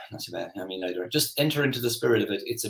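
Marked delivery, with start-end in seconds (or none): 0.1-1.1 low, soft morning mumble; unenthusiastic reply. Acted. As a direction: answer with no spirit at all, quiet and low. Contrasting dreary morning greeting.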